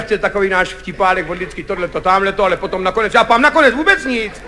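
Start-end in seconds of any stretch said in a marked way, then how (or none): 0.0-2.4 low, quiet dismissive director; lightly voiced director brushing it aside; Kachyňa. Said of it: "Kachyňa už to nebral v potaz a povídá". (tlumený, hlubší hlas režiséra)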